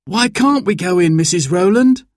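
put-on high child's voice, rising question; curious child. A narrator's reading. Boy questions the neighbour, curious and high-pitched.

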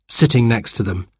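usual speaking voice

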